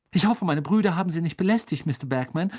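high, quick, officious politeness; eager to please. A narrator's reading. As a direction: fuss over an important guest. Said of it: "sagte Percy beflissen".

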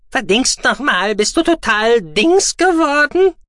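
high, loud scolding squeak; outraged, squeaky creature voice; furious small creature. A narrator's reading. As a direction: rant angrily in a high-pitched little voice. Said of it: "dass ihm jemand wütend gegen das Schienbein trat".

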